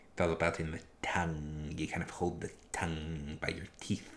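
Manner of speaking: lightly voiced Vincent Price, tongue against teeth; hint of a spooky old horror host. Shown as a demonstration. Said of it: "if you want to do the Vincent Price".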